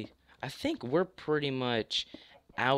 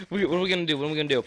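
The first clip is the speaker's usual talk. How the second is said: ordinary talk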